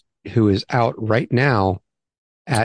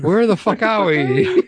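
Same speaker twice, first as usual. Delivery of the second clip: high, drawn-out mock call; sing-out gag delivery, well above normal pitch; high-pitched joke cry; 11 semitones higher, slower than usual. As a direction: stretch the punchline out high and slow. Repeats the joke punchline.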